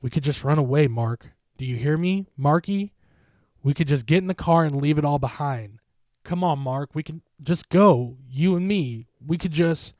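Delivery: loud, rising desperate plea; pleading. A narrator's reading. Jen begging Mark to flee together, frantic plea. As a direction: beg him to run away with her.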